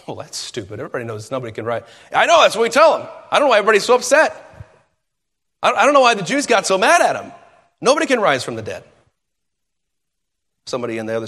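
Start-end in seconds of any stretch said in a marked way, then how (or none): 0.1-1.8 muttered dismissive scoff, fast at the end. Retold or imitated. Break-room guard dismisses Paul's claim, quiet scoffing. 2.1-6.7 louder, fast exasperated agreement. Retold or imitated. Guard agrees with his coworker, loud and exasperated.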